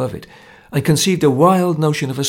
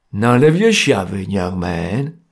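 a touch of stern warning; severe; 4 semitones lower, slower than usual. A narrator's reading. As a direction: put him in his place, mildly gruff. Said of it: "said Jerry severely".

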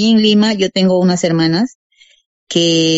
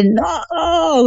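high, anguished cry of no; half-recalled scream of no, pitched high; retold anguished cry; 8 semitones higher than usual. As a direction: cry out the no as if hearing of the death. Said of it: "yo di un grito que yo dije".

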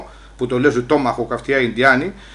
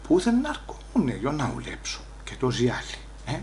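trailing into a near whisper; inner voice, faded; 11 dB quieter than usual. Retold or imitated. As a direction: mutter it to yourself, fading out. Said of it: "λέει από μέσα μου". (σιγανή εσωτερική φωνή που σβήνει)

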